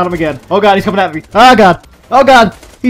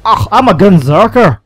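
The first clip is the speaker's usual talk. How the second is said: louder, drawn-out mock Scottish accent; 3 dB louder, slower than usual. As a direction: put on a broad Scottish accent. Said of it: "That's a Scottish accent".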